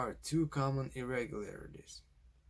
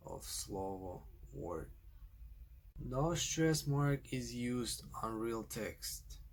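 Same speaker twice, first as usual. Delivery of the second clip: normal talking voice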